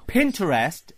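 "Pinterest" as Pinter-est, like Harold Pinter; three-syllable "Pinterest", PIN-ter-est; mispronounced word. Shown as a demonstration. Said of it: "is like the writer Harold Pinter".

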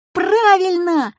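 high, drawn-out cheer of agreement; chiming in. A narrator's reading. Classmates agreeing with Петька, excitedly chiming in. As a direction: join in excitedly, stretching the word. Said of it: "подхватили другие ребята".

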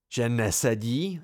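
slow, stretched-out incredulous echo; incredulous exclamation. Acted. Simon challenging his friend's doubt, incredulous. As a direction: throw the words back in mild disbelief. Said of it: "zvolal Simon".

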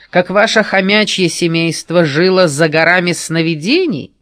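bully him hard; menacing. Acted. Rogonos threatens Gryz.

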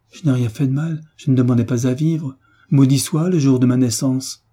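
acted anguish, building in force; anguished protest. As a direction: protest innocence in growing despair. Desperate young man protests, curses his birth.

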